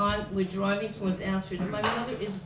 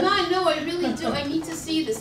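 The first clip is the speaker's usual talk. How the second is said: louder, higher pleading with mother; insistent protest; 6 semitones higher, 6 dB louder than usual. Retold or imitated. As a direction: push back urgently, raised voice. Pleading back at her screaming mother.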